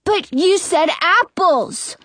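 put-on high, drawn-out child's protest; insisting. A narrator's reading. Brother challenging the old lady, insisting. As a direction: insist with the pitch well up. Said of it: "Jonah insists".

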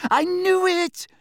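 very high, excited squeal; excited shriek. A narrator's reading. Hermione spots the badge, shrieking with excitement. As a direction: shriek it in triumph. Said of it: "let out a shriek".

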